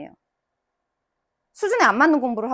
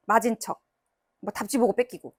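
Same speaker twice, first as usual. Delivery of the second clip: ordinary talk, nothing marked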